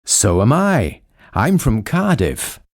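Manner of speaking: ordinary talk, nothing marked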